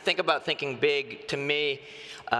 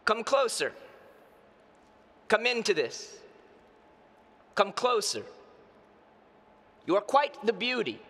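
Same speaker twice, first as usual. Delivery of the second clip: performed poem, higher than chatting voice; poem recitation; 7 semitones higher than usual. Acted. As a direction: recite it as verse, each phrase set apart and falling at the end. Recites his poem on stage.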